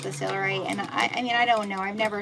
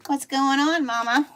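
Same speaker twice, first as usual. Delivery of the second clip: high, loud sing-song to the dog; pet talk to dog; 7 semitones higher, 10 dB louder than usual. Real, not acted. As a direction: coo at your dog, bright and much louder. Cooing to her dog.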